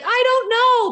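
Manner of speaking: high, loud whiny brain voice. Retold or imitated. The brain whining when asked what to do next. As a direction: whine it like a stuck brain. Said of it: "when the brain's like".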